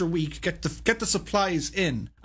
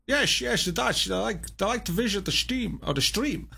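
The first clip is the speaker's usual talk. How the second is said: put-on Dutch accent, pitched up at the start; 3 semitones higher than usual. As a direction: do your best jokey Dutch accent. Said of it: "my best accent of a Dutch person".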